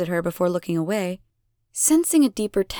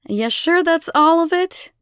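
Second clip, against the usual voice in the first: higher, louder taunting question; pointed questioning; 9 semitones higher, 5 dB louder than usual. A narrator's reading. Elena questions the trapped children, taunting. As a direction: press them, voice raised.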